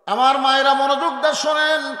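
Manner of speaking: high, loud preacher's call. Real, not acted. Preacher calling mothers to hear the Quran, calling out. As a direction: summon the crowd's attention at full voice. Preacher calls women to listen.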